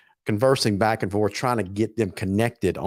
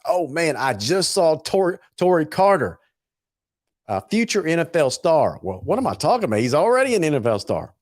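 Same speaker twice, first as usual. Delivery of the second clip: higher-pitched excited burst; 6 semitones higher than usual. Real, not acted. Host spotting the star guest backstage, excited. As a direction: react with real excitement.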